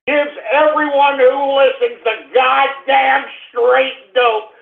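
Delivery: usual speaking voice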